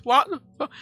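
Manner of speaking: high-pitched baffled reaction; bewildered. Retold or imitated. Hearing the noise in bed, bewildered. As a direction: exclaim it high, in acted disbelief. Retold reaction to strange horn.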